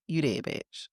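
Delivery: ordinary delivery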